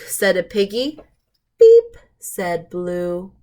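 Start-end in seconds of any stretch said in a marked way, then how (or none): 1.6-2.0 a squeaky toy-truck beep, way up high; high horn beep. Acted. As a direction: beep like a tiny friendly horn. Truck's horn in a picture book.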